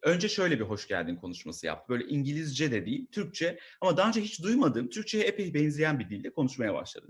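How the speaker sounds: ordinary delivery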